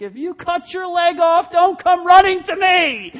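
high, very loud paternal shout; hammed-up angry dad yell; yelling father. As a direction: bellow a warning across the lawn, stretching the end. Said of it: "some father yells to".

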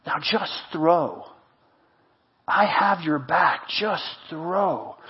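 soft, low and slow; gentle reassurance. Acted. Encourager telling someone who made mistakes to go on, softly reassuring. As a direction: let each phrase land quietly.

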